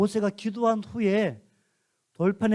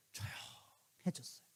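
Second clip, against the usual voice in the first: hushed; 4 semitones lower, 19 dB quieter, slower than usual. A narrator's reading. Sudden hush after tablets break.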